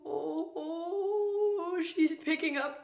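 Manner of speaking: high, drawn-out "oh" slowing into a wail; mock worried wail. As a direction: stretch the words out in playful dismay. Acted reaction, drawn-out high oh.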